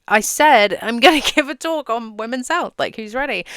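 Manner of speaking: a half-laughing repeat to a bored crowd; insistent call. Pretend. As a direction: demand attention from a room of phone scrollers. Speaker repeats to an ignoring crowd.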